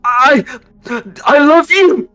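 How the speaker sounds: high, loud cry of love; desperate dying father. Acted. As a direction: call out to your daughter as you die. Dying father calls to daughter.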